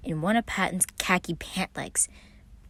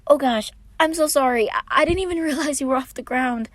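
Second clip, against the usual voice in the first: higher, louder flustered apology with a laugh; embarrassed apology; 9 semitones higher, 7 dB louder than usual. A narrator's reading. Patton apologising to Roman after lifting him, flustered. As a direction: fumble the sorry, flustered. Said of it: "Patton looked so embarrassed".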